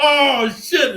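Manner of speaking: high, loud mocking yelp. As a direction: toss off a high mocking cry. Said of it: "[name] talking about".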